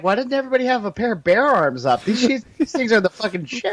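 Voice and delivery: high-pitched mock-amazed outburst; hammed-up excitement, pitched well up; amazed founders. Imagined founders marvelling at modern weapons, mock-amazed. Acting out founders' reaction to guns.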